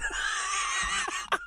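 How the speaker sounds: soft wheezing laugh; helpless laughter. Real, not acted. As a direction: laugh until only air is left. Cracks up after his question.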